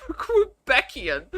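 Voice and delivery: word breaking up into laughter; laughing. Real, not acted. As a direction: crack up as you say it. Cracks up at a joke word.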